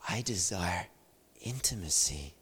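soft, low and hushed; a quiet divine whisper; hushed voice of God. Retold or imitated. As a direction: speak it gently as the Father's voice. Voice of the Father, hushed.

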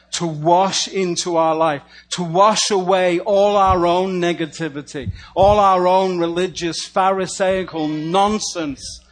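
loud, lifted praying voice; fervent prayer. Acted. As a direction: pour the prayer out with force. Praying for baptism, building.

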